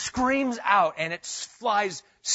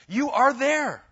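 raised high and loud, an exclaimed declaration; exclamation; 8 semitones higher, 7 dB louder, slower than usual. Shown as a demonstration. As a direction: proclaim it like an exclamation. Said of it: "the E S V does it in exclamation".

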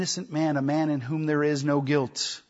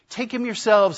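louder, higher mocking retort; sarcastic jab; 7 semitones higher, 5 dB louder than usual. Pilate jabbing at the priests, mock sarcastic. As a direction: throw it back at them as a jab. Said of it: "he says".

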